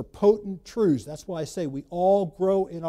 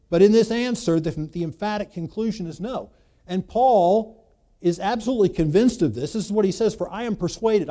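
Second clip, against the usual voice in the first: louder, driving sermon delivery; forceful preaching; 3 semitones higher, 8 dB louder than usual. Acted. Pastor pressing Paul's conclusion on the congregation, forceful preaching. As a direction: preach it out with conviction.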